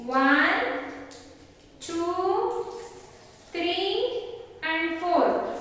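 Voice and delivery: slow counting aloud with pauses; teaching a child to count. Shown as a demonstration. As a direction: count each chocolate out for the kids. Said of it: "Shall we count how many chocolates".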